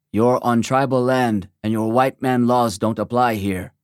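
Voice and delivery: weird, stiff monotone delivery; stilted monotone. A narrator's reading. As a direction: deliver it stiffly in a flat monotone like an old Western. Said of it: "in a weird, stilted monotone".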